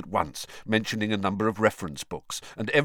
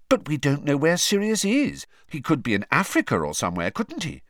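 patient sensible tone, higher; reasonable; 8 semitones higher, 4 dB louder than usual. Acted. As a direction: lay out the logic calmly. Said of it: "said Hermione reasonably".

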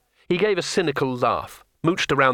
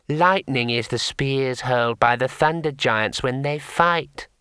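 gentle imp; 2 semitones higher, slower than usual. Acted. Imp explaining lightning to the wizard, gentle. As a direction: patiently correct him like a tiny sage. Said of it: "it said gently".

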